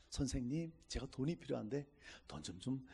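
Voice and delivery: soft, meek pleading request; mock polite gangster. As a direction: sweetly beg for money like a fake-polite thug. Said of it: "이런 깡패 없어요".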